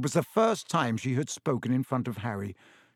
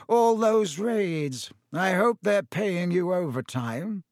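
slow, higher-pitched aristocratic villain, taunting; Lucius Malfoy, sneering; 4 semitones higher, slower than usual. Acted. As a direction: needle him with drawn-out mock concern.

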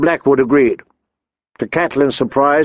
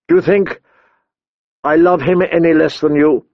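voice almost breaking; 3 semitones higher than usual. A narrator's reading. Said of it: "Her voice almost broke with her grief".

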